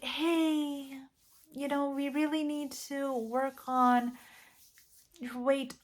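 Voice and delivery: a touch of sugary niceness, not pushing; overly nice. Retold or imitated. Gently raising weight with a loved one, overly nice. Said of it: "you're being really nice".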